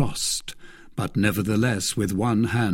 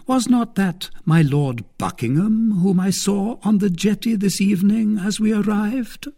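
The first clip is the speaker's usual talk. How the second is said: higher, probing lady's voice; a cautious noblewoman fishing; Milady; 11 semitones higher, 3 dB louder than usual. Acted. Milady fishing for news about Buckingham, higher voice.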